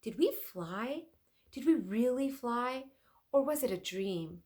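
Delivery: stunned inventor asking in awe; amazed Leonardo. Acted.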